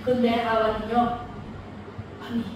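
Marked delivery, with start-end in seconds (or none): 2.3-2.4 a mock firm refusal; flat refusal. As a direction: cut it off dead, no debate. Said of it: "이렇게 딱 잘라버렸으면".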